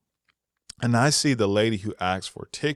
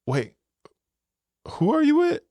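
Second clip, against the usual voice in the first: mock question. Staff asking him at the door.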